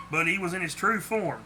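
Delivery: ordinary delivery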